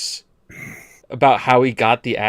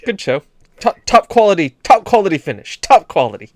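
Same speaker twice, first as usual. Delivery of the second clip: posh British commentator; 3 semitones higher, 4 dB louder than usual. Pretend. Mock posh polite sportsman.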